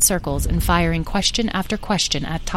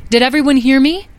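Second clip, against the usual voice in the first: loud, high parental check; calling out; 8 semitones higher, 7 dB louder than usual. A narrator's reading. Beatrice checks that her children heard, calling out. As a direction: call out to the whole brood. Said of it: "called to her children".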